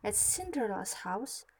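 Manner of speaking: usual speaking voice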